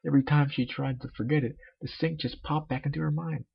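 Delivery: usual speaking voice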